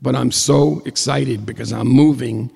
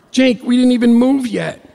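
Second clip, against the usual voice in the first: pitched way up and louder, indignant; high exasperated protest; 11 semitones higher, 5 dB louder than usual. Retold or imitated. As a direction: squeal it out in disbelief.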